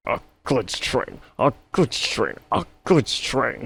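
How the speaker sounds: high, lightly voiced grumble; a hint of a sulky engine; grumbling Gordon. A narrator's reading. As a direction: grumble with wounded pride. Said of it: "he grumbled".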